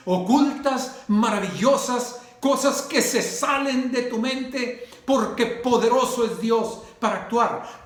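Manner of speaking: loud, fervent sermon delivery, each phrase flung out; rising preacher's proclamation, loud and driving; preaching at full force. Acted. Preacher calls on God's promise.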